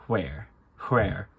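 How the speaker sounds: Irish "where" opened with a puff of H; breathy Irish WH. Shown as a demonstration. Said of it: "it's breathy. So you go".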